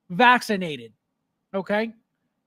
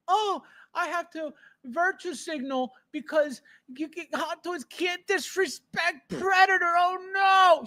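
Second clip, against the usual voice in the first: mocking whiner; 6 semitones higher, 4 dB quieter than usual. Mocking virtue signalers.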